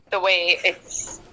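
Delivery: usual speaking voice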